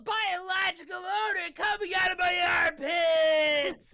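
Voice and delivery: loud, high, drawn-out surfer shout with a laugh; surfer dude. Acted. [name] bragging about his armpits to the class, loud surfer yell. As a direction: stretch it like a proud surfer bro. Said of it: "That was better than I could have imagined".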